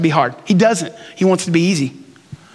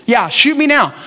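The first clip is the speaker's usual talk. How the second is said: ordinary delivery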